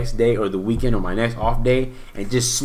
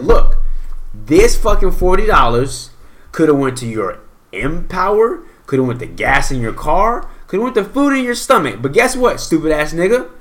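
loud, emphatic telling-off of yourself; worked-up lecture to yourself; self-scolding; 5 semitones higher, 4 dB louder than usual. Retold or imitated. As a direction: berate yourself, punching key words louder and higher. Scolding himself for overspending.

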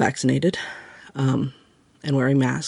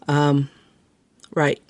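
normal talking voice

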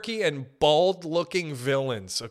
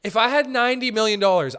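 excited outburst; 8 semitones higher, 6 dB louder, faster than usual. Acted. Repeated excited opening line.

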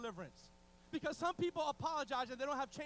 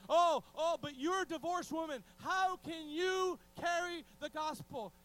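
loud, high mock indignation; hammed-up accusatory outcry; accusing interviewers; 4 semitones higher, 8 dB louder, slower than usual. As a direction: challenge her loudly, as a scandalized questioner. Acting out accusing interviewers of preacher.

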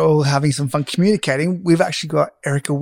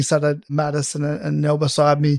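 ordinary talk

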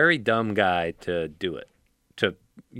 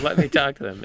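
mock woman's voice; 5 semitones higher than usual. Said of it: "He said".

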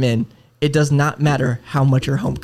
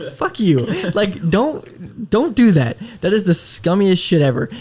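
higher-pitched retold indignation; exasperated outburst; 6 semitones higher, slower than usual. Agent venting to co-hosts about rude caller, exasperated. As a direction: vent it, half laughing at the start.

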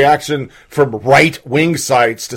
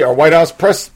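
normal talking voice

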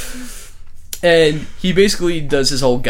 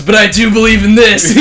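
higher and louder, a mock threat yelled out; menacing shout; 9 semitones higher, 9 dB louder than usual. Retelling the boardroom scene to his cohost, a hammed-up menacing yell. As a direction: punch it out like a movie villain.

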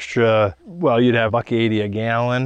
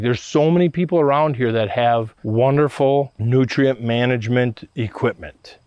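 ordinary talk

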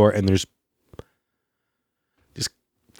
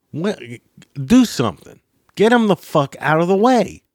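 angry yell; 8 semitones higher than usual. Retold or imitated. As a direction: snap at her to move. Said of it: "handled something like that".

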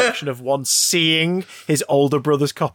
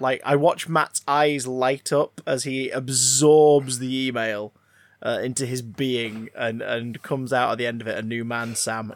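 slow, softer, lower reverent tone; mock tender; 6 semitones lower, 3 dB quieter, slower than usual. Host mock-tenderly describing his co-host reading the email. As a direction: savour it like a love letter.

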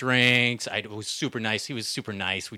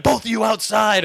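firm order; 10 semitones higher, 6 dB louder than usual. Retold or imitated. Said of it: "I'd been like".

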